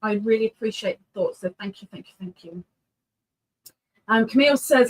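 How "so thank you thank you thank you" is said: softer and softer thank yous; soft trailing thanks. Real, not acted. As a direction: fade out into thanks.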